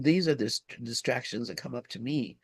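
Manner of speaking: ordinary talk, nothing marked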